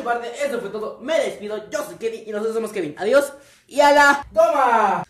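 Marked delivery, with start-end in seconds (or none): 3.0-5.0 loud, slow signature goodbye; shouted sign-off. Real, not acted. Catchphrase sign-off.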